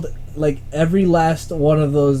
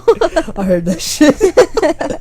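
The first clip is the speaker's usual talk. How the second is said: high, slow, through laughter; laughing; 11 semitones higher, 6 dB louder, much slower than usual. Real, not acted. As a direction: drag it out while cracking up. Laughing hard at the joke.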